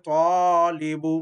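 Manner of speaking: teacher stretching the alif; slow model reading of তালিবু; drawn-out long vowel. Shown as a demonstration. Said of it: "এক আলিফ পরিমাণ টেনে পড়তে হবে".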